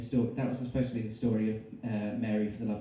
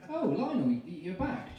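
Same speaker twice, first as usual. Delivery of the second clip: nervous high voice; 6 semitones higher than usual. Acted. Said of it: "John said".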